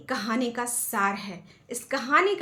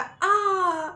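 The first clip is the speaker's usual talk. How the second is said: cry of pain; 8 semitones higher than usual. A narrator's reading. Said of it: "चोट लगी तो उसने बोला".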